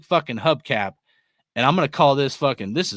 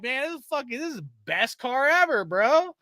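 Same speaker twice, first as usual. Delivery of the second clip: high, soft, dreamy brag; mock proud cruiser; 9 semitones higher, 5 dB quieter than usual. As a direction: swoon over a junk car, softly and higher. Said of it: "You're like".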